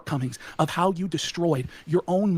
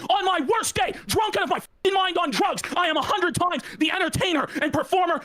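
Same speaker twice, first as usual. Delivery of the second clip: pitched-up boast; 8 semitones higher than usual. Acted.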